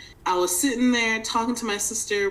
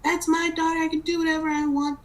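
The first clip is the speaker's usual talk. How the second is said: defiant mom; 9 semitones higher, faster than usual. Retold or imitated. Mom voiced in phone fight.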